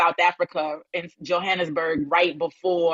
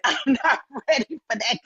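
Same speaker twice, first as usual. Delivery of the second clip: laughing; 5 semitones higher than usual. Real, not acted. Joking about cave initiations.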